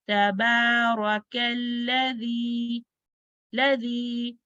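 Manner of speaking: slow, level recitation of तबारकल्लज़ी with held long vowels; two-count elongation. Shown as a demonstration. Teacher shows students the two counts, elongated recitation. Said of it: "read like a two counts".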